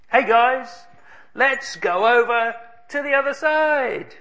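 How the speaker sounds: high, bright call to the crew; rally the boys with upbeat pitch; cheerful call. Acted. Jesus calling his disciples to the boat, cheerful and high.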